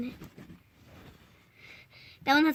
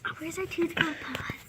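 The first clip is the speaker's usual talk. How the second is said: hushed whisper; 10 dB quieter than usual. Real, not acted. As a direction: whisper it close. Whispering near the hidden cat.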